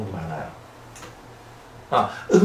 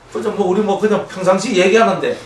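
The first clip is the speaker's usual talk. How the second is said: ordinary delivery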